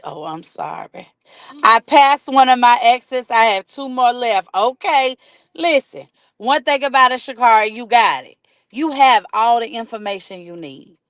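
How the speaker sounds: normal talking voice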